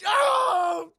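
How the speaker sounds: very loud, high wail of protest; pained shout. Retold or imitated. Jackie Chan hit by his partner, pained shout. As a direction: howl at the partner who just hit you.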